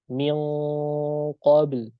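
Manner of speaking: Min qabl with the back of the tongue pressed up for the q; ikhfa before qaf. Shown as a demonstration. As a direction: press qabl hard from the throat, slow. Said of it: "Tidak boleh mil". (min qabl dengan pangkal lidah menempel)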